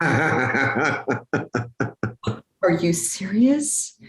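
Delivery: slow, breathless whisper of disbelief; stunned whisper. Retold or imitated. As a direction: barely voice it, stunned and slowed. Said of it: "But I was like".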